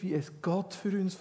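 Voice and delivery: ordinary talk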